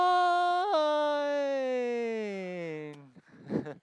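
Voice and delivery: high, loud burst of laughter; big laugh. Real, not acted. Laughs at his own punchline.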